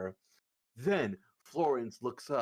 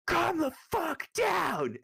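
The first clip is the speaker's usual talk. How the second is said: high, slow shout; 7 dB louder, much slower than usual. Acted. Kale shouts at drunk James, slow high yell. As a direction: bellow it at him. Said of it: "Kale comes over ... goes".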